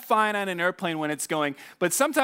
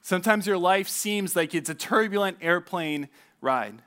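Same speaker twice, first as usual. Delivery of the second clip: usual speaking voice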